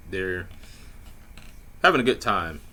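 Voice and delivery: ordinary talk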